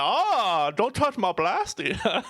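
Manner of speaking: laughing mock Maine accent, drawn out and slow; hammed-up Maine local guarding his blastia, Maine accent. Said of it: "made me think of a Maine accent".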